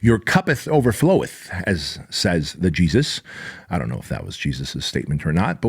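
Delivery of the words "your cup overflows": higher, hammed-up preacher tone; mock holy. Host mock-quoting scripture, higher and hammed-up. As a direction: play at sounding biblical. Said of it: "it sounds very religious and holy".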